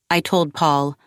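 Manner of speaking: ordinary delivery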